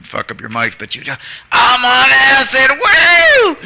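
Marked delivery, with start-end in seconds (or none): 1.5-3.6 high, drawn-out wild whoop and shout; full-volume yell. Retold or imitated. Woman yelling into the camera mics, full-volume holler. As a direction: bellow it slowly at full volume, ending in a shrieked woo. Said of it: "she'd yell full volume".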